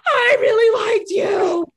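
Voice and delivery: high, loud, tearful wail; crying plea. Retold or imitated. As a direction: cry it out like a hurt lover. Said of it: "he was like".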